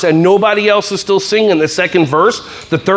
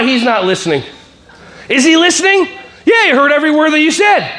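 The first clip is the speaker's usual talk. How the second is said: ordinary talk, nothing marked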